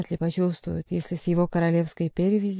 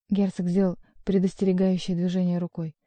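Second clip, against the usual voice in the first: usual speaking voice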